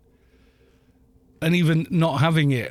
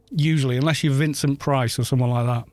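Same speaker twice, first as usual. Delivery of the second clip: normal talking voice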